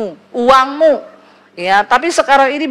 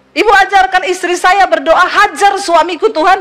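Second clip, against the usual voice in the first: higher, indignant complaint from a husband on the phone; angry husband; 8 semitones higher than usual. Retold or imitated. Angry husband scolds the pastor for teaching that prayer, high and indignant. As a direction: throw her prayer back at the pastor, outraged.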